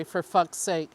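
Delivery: ordinary delivery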